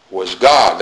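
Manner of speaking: "God" weighted and falling after a short "was"; stress on God. Shown as a demonstration. Preacher repeating John's emphasis, stress on "God". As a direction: set "God" down firmly, "was" unstressed. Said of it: "That's the emphasis that John is placing".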